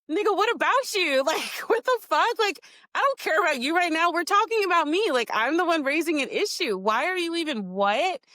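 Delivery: high, indignant mock retort; exasperated retort. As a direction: fire it back at him, outraged. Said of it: "And I'm like".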